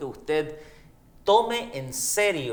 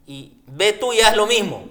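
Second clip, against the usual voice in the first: punchline verse; 6 semitones higher, 8 dB louder than usual. Pretend.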